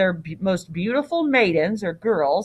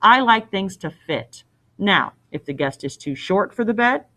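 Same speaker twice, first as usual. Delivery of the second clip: ordinary delivery, nothing marked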